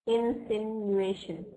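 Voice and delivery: INSINUATION very slow, in-sin-yoo-AY-shun; careful pronunciation. Shown as a demonstration. Teacher testing how to pronounce it, INSINUATION drawn out slowly. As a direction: stretch out "Insinuation" syllable by syllable. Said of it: "is this the correct way of pronounce".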